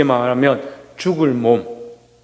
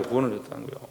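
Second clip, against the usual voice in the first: 구원을 얻었다는 거예요 repeated almost under the breath, much softer; preacher repeats the point to himself, much softer; soft echo; 5 semitones lower, 14 dB quieter, much faster than usual. Shown as a demonstration. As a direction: echo it back very quietly. Soft echo of previous line.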